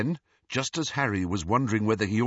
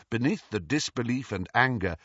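ordinary delivery

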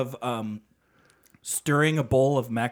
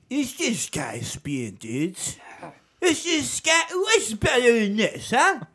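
dude-bro character voice; 7 semitones higher, slower than usual. Pretend. Character voice bit.

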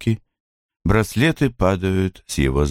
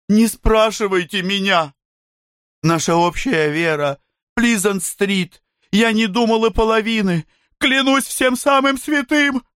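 high, pleading voice climbing higher; frightened pleading. Acted. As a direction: beg and swear in a rising panic. Bloom pleads under interrogation.

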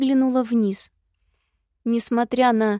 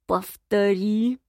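slow, threatening command; menacing drawl; much slower than usual. A narrator's reading. Night visitor demanding a repeat, menacing drawl. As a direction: draw the word out as a threat. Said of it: "угрожающе протянул ночной гость".

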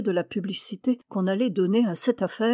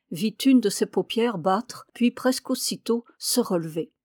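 ordinary delivery

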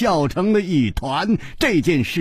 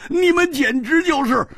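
much higher, shouting, stretched slow on 终身大事; desperate outcry; 10 semitones higher than usual. Acted. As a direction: wail in aggrieved protest. Friend protests being forced. (委屈地高声大喊)

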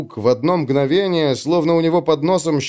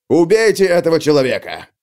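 loud cold order; gruff space marine; 4 semitones higher, 3 dB louder than usual. Acted. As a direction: bark the kill order. Captain orders a killing.

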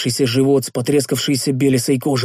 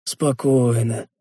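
slow, calming reassurance; soothing voice; much slower than usual. A narrator's reading. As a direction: gently settle him down, slowly. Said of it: "увещевающим голосом проговорил я".

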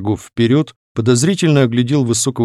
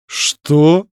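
startled, higher-pitched query; stunned by the stranger's insolence; taken aback; 5 semitones higher than usual. A narrator's reading. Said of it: "опешив от наглости незнакомца". (ошарашенно)

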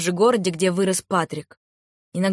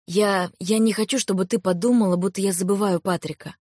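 usual speaking voice

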